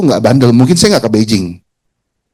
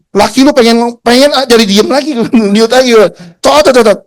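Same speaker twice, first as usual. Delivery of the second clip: taunting, much higher and louder, the cot cot chattering; mocking taunt; 11 semitones higher, 3 dB louder than usual. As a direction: rub it in with a high, sneering cackle of cot cot. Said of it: "gue ngeledekin kayak tadi".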